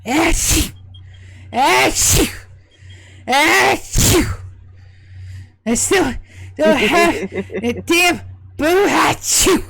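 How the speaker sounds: high, loud exaggerated sneezes; sneezing fit. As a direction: sneeze and sneeze, then sneeze mid-word. [name] sneezing repeatedly.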